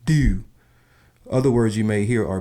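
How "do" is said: "do" with a strongly stressed U, loud and higher; "do" with a fronted Baltimore U; stressed U sound. Shown as a demonstration. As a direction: punch the U in "do" like a Baltimorean. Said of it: "the stress of the U sound in many words".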